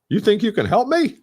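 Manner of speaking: high, rising disbelief on me; incredulous. Retold or imitated. Prospect doubting the salesperson, pitched high in disbelief. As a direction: scoff at the idea that anyone could help.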